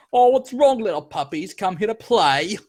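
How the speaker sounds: high, taunting thug voice; mocking thug. Thug taunting the party as puppies, high mockery. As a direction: taunt them like they're little dogs. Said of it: "It's like".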